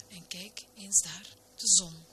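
ordinary delivery

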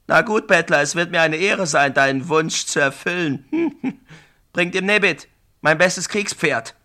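scornful, laughing through the words; mocking laugh. Brafane laughs at the beggar while granting his wish. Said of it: "brach in schallendes Gelächter aus".

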